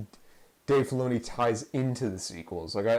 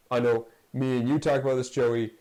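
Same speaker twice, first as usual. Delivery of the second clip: normal talking voice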